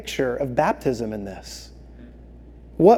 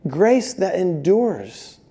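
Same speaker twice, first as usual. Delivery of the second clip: slow emphasis; 4 dB quieter, much slower than usual. Acted. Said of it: "slow emphatic line".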